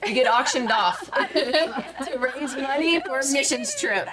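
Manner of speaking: slow, laughing through the words; chuckling at the hillbilly auction; laughing while telling. Real, not acted. Telling the hosts about the church auction, laughing.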